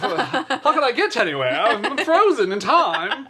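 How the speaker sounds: high, laughing mock despair. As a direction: laugh through a fake panic. Mock helpless without AirPods.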